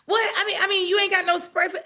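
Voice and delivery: high, loud, pleading; mock exasperated friend. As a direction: play the friend insisting on bug spray. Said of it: "friend voiced in retold story".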